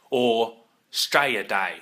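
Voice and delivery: slow, drawn-out Straya Day. Shown as a demonstration. Teaching viewers the local way, slow broad Aussie. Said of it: "if you want to be more authentic".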